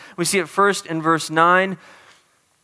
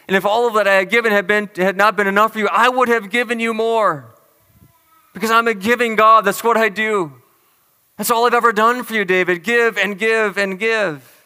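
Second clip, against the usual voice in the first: building fervour; 4 semitones higher, 4 dB louder than usual. Acted. Voicing God's giving speech.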